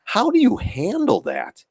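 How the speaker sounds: high, slow, awed disbelief; stunned disbelief. Retold or imitated. Watching a high roller bet big, asking in slow, high amazement. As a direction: gape at a huge blackjack bet in slow disbelief. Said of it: "I'm just sitting there going".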